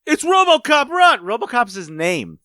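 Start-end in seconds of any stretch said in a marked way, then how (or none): 0.0-1.2 high panicky yell; mock panic shout. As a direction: cry out to run. Bystander yells and flees.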